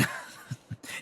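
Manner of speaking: breathy unvoiced snicker; quiet snicker. Narrator snickering at his ancestors' poor work. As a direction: snigger at the family's failure.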